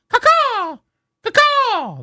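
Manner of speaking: high squawking caw-caw like a bird; birdman caw call. Retold or imitated. Host mimics announcer's bird call, high squawk. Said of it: "The Birdman on C B S".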